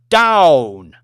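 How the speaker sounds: sharp loud command, one word; barked order. Acted. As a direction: bark it at the cowering waitress. Lady Elizabeth orders waitress into bin.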